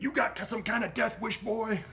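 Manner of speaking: put-on southern accent, voice pitched well up; southern cop accent. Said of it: "I talk like he's got a southern accent".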